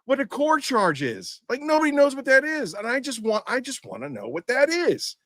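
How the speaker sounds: arguing with a snippy manager, voice climbing; exasperated retort. Retold or imitated.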